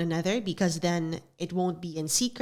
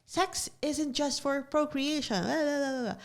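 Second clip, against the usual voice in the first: higher-pitched mock of her younger self; mocking old self; 8 semitones higher, 3 dB quieter than usual. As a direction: parody your old preachy self, pitched up and trailing into babble. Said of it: "and I was like".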